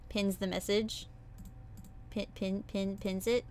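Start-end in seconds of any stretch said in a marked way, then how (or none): none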